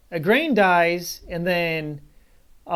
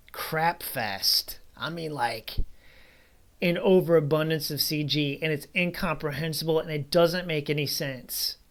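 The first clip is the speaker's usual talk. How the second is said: usual speaking voice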